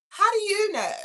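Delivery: high-pitched incredulous question; playful suspicious questioning. Real, not acted. Pressing a commenter on furry knowledge, playfully suspicious. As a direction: press them in a high, disbelieving voice.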